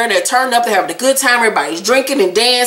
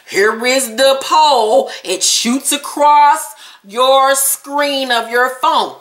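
exaggeratedly slow, emphatic instruction; slow, deliberate explanation; 3 semitones higher, much slower than usual. Real, not acted. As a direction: stretch each word out slowly. Spells out poll for slow viewers.

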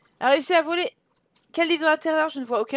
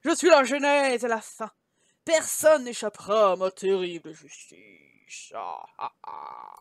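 sinister book voice; 7 semitones lower, 5 dB louder than usual. A narrator's reading. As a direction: boom it out, sinking into a very low evil laugh. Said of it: "une voix semblable au cri de mille corbeaux affamés".

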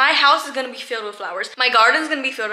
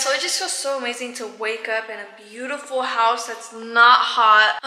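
ordinary delivery

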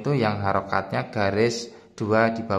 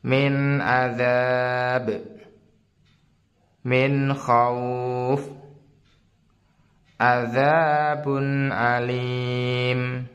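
slow, sustained reading with long held vowels; model Quran recitation; much slower than usual. Shown as a demonstration. Teacher reads for learners to check against, slow tajwid recitation. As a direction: recite each phrase measured and drawn out, stopping on closed endings. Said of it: "Oke, saya mulai".

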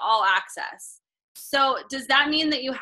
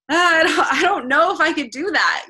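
hesitant reluctance; 5 semitones higher, 4 dB louder than usual. Retold or imitated. Voicing a reluctant reaction.

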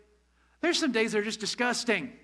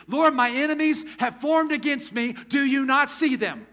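raised, louder voice, indignant pleading with God; David's angry complaint; 5 semitones higher, 6 dB louder than usual. Retold or imitated. Pastor voicing David's angry psalm to the congregation, indignant and loud. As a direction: let the frustration push up in pitch and volume. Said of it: "he was angry".

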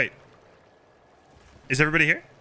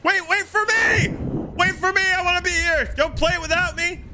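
very high, squealing call; urgent plea; 13 semitones higher than usual. Real, not acted. Late player begging the crew to wait, high urgent plea. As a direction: cry out high so they hold off.

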